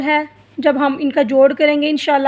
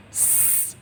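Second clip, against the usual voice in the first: whistled s; 23 dB quieter than usual. Shown as a demonstration. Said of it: "सीटी की तरह होगी".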